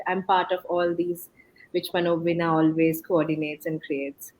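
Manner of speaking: usual speaking voice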